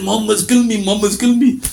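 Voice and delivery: high whiny cry; hammed-up dying coward; mock wailing. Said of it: "He goes".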